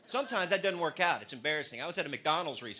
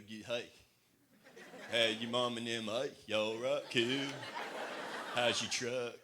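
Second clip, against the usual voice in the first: lightly put-on Texas accent, lower; Texan drawl; 5 semitones lower, 3 dB quieter, slower than usual. Comic imitating how he talks in Texas, mild Texan accent. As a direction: slip into a relaxed Texan voice. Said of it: "I'm like".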